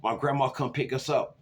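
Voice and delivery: ordinary delivery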